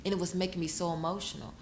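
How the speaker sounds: usual speaking voice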